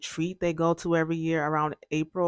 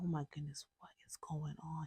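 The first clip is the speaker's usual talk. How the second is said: hushed, worried whisper; 21 dB quieter than usual. Retold or imitated. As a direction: breathe it out in alarmed disbelief. Mom whispering in worry.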